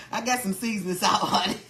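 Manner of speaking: ordinary talk, nothing marked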